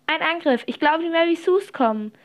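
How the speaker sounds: high-pitched warning call; play-panicked shout of warning; alarmed cry. A narrator's reading. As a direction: raise the alarm urgently. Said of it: "rief sie alarmiert".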